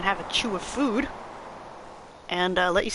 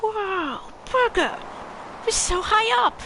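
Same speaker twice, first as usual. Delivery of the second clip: much higher, excited character voice; an excited kid impressed; 11 semitones higher than usual. Acted. Voicing the game's dialogue, much higher and amazed.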